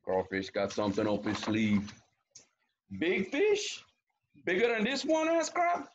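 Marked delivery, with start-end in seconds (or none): 3.0-5.9 high, rising questions; high-pitched crab character voice; curious crab. A narrator's reading. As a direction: ask it high-pitched and eager, as a storybook crab.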